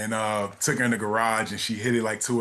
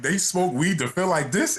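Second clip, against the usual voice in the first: incredulous; 7 semitones higher, slower than usual. Retold or imitated. Recalls his paranoid first high.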